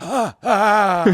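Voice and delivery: high, gasping imitation of crying; mock sobbing gasps. Retelling their reaction to the film's ending, mock sobbing gasps. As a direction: gasp and wail in mock heartbreak.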